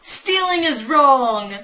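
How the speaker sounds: loud, drawn-out scolding catchphrase; mock scolding. Fan throwing Andy's catchphrase at prisoners, loud mock scolding. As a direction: scold it slowly and loudly. Running catchphrase shouted in mockery.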